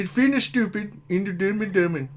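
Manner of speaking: high-pitched fake Swedish babble; mock Swedish accent. Streamer defending the impression, mock Swedish accent. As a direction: slip into silly Swedish-chef talk.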